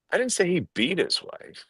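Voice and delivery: "beat" picked out with a pitch jump; stress on BEAT. Shown as a demonstration. Trainer showing how emphasis changes meaning, stress on "beat". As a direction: hit the word "beat". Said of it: "when I emphasize different words".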